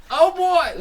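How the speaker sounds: high, loud glee; light up with joy; delighted cry. Acted. Delighted reaction.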